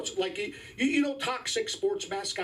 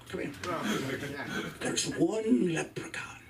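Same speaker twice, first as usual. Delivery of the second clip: conspiratorial whisper. Pretend. Conspiratorial hush to crowd.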